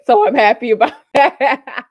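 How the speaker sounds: laughing. Real, not acted. Laughing about video length.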